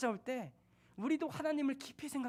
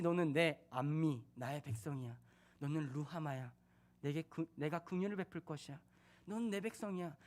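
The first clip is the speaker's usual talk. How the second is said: tender; 8 semitones lower, 7 dB quieter than usual. Acted. God loves again, tender.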